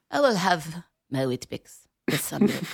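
slow, put-on Italian accent over breakfast; mock Italian accent. Host mocking an actress's method accent, mild Italian accent. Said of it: "I spoke with an accent".

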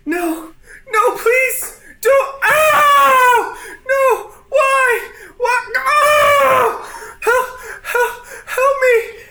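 high, loud sobbing pleas for help; terrified crying pleas. Acted. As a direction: scream and beg through tears. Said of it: "you hear Sierra going".

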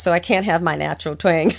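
ordinary delivery

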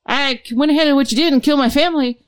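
high, chirpy report to Satan; cheerful caller. Retold or imitated. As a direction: chirp it like a proud errand boy. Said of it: "you're like".

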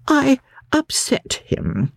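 high, slow, fretful invalid; Mr Fairlie's fretful voice. A narrator's reading. Ailing guardian brushing off the family lawyer, high, slow and fretful. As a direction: sigh it out high and slow like a weary hypochondriac. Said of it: "he shut his eyes and said".

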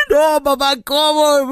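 mock wail in a high squeaky voice; llorando en burla. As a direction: imitate a grown son bawling to his dad. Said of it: "Hasta chilló y todo el rollo". (lloriqueo agudo y exagerado)

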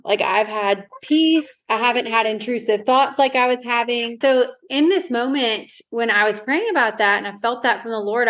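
ordinary talk, nothing marked

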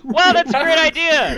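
high loud fake enthusiasm; mock excitement. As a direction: gush over it, hammed up. Sarcastic over-the-top enthusiasm.

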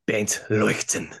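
put-on name said in a German accent. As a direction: give it a proper German pronunciation. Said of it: "in your loving German, German accent".

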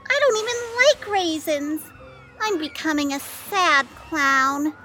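mournful sad clown whine. Acted. Clown dressed as raisin laments.

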